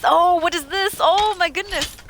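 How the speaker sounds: high, loud startled exclamations; playful alarm, pitched high. Pretend. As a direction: react as if suddenly ambushed. Startled by a robber in game.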